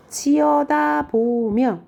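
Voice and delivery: 치여다보며 stretched very slowly on a high pitch; drawn-out syllables. Shown as a demonstration. Teacher showing the poem's added syllable, 치여다보며 drawn out. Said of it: "글자 수를 느린 이유가 뭘까요?".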